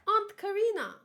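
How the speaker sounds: high loud call across the crowd. Acted. Gabi calls out to Reiner's mother across the street. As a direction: shout her name to catch her ear.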